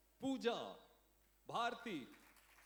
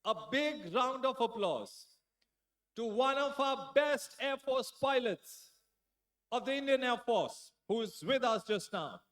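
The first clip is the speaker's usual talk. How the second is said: loud, slow, drawn-out emcee call; stage announcer; 7 semitones higher, 13 dB louder, much slower than usual. Acted. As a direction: build the big introduction. (मंच से ज़ोरदार धीमी घोषणा)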